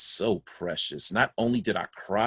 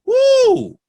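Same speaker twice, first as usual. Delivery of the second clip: high, loud mock whoop; a big hollering woo; 12 dB louder than usual. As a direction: let out a wild whoop. Father exclaims about wife's fury.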